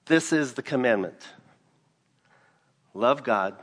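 usual speaking voice

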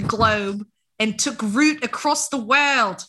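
Australian accent, mock, breaking into laughs; bad Australian accent. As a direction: play a cheesy Aussie documentary host. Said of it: "You like my Australian?".

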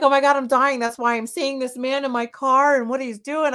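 high, loud, alarmed retelling; retold panic. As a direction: re-enact a frantic call to a friend. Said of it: "because I thought I was dying".

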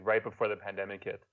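ordinary talk, nothing marked

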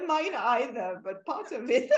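laughing loudly through the words. Real, not acted. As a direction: agree with a real loud laugh. Laughing agreement.

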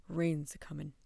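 put-on low, loud-edged old man's grumble; stubborn grumble. A narrator's reading. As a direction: grumble it low, refusing to budge. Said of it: "the man grumbled stubbornly".

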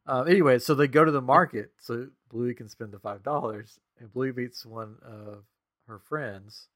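usual speaking voice

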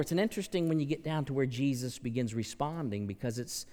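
ordinary talk, nothing marked

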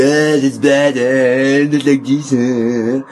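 grumbling Frenchman. Retold or imitated. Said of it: "the French would be".

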